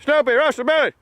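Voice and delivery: high sing-song call to the dog; high call to a pet. Real, not acted. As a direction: call out to Snoopy across the field. Calling the dog over.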